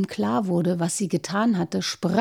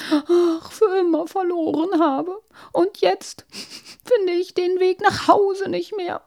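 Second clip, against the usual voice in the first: tearful princess; 9 semitones higher, 3 dB louder than usual. Acted. Princess sobs to wolf.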